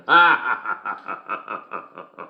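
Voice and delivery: long laugh trailing into chuckles; hearty laugh. Acted. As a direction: burst out, then chuckle it down. Cracks up at own joke.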